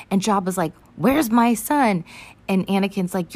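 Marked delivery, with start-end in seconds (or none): 1.0-2.0 slow, loud, high demand; raised-voice, drawn-out acted retort; Jabba. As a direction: drag out the demand in mock outrage. Jabba demands his son back.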